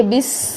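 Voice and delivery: بس ending in a long, thin hiss; soft بس with the s held; whistling seen. Shown as a demonstration. Teacher showing seen's whistle, بس with a thin sustained s. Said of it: "یہ سین کی سیٹی اور باریک".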